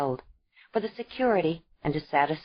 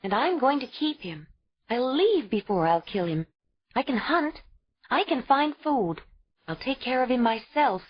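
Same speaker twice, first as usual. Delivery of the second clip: higher, insistent and proud; defiant young mother; 7 semitones higher than usual. A narrator's reading. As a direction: fight for the child. Ayla resolving to leave.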